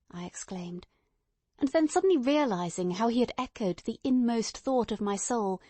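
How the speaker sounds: plain reading voice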